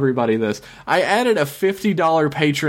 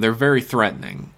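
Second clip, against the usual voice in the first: ordinary talk, nothing marked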